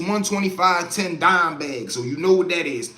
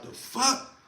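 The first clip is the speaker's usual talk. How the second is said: whispered confusion; 6 semitones higher, 8 dB quieter than usual. Retold or imitated. Woken by banging, confused.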